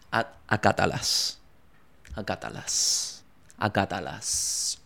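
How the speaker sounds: slow hushed whisper; whispered command. Pretend. Telling fans to obey rules, slow whisper. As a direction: breathe the word out slowly. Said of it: "slow whispered command".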